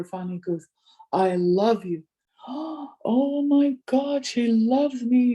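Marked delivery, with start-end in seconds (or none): 1.1-2.0 fully voiced, firm but not shouted; louder, voiced confession. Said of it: "he got louder". 2.2-5.3 slow, higher-pitched, overwhelmed quote; retold tearful joy. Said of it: "He was just crying".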